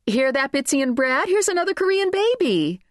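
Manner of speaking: high, excited calling out; excited onlooker. A narrator's reading. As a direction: eagerly announce it to friends.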